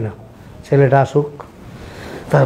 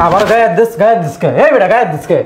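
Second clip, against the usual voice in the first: angry shouting; 5 semitones higher than usual. Acted. Brother shouting at brother.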